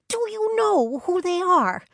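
very high, loud excited question; excited Brianna. A narrator's reading. Brianna asking friends if they know the twins, excited. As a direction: burst out in a high, thrilled voice.

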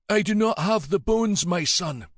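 a dying abbot holding firm; old abbot. Acted. Dying old abbot to the Viking raiders, high and frail.

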